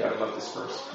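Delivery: getting weepy. Real, not acted. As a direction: admit quietly that you are close to tears. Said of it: "I'm gonna get weepy".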